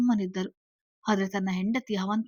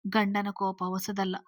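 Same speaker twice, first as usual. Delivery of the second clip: plain reading voice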